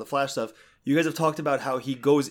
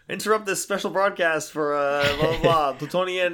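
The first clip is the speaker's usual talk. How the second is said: mock newscaster, higher and louder; hammed-up breaking news read; news announcer; 3 semitones higher, 4 dB louder than usual. Newscaster voice in retold scene.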